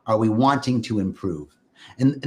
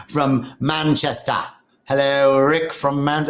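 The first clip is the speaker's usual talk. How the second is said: Manchester accent attempt; slower than usual. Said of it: "that's not the Manchester accent".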